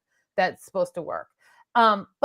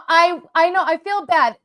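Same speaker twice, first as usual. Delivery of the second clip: usual speaking voice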